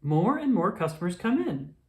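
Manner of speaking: ordinary delivery, nothing marked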